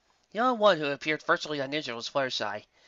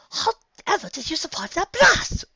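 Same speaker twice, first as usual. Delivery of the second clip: posh Rarity; 7 dB louder, slower than usual. Acted. Rarity's refined voice.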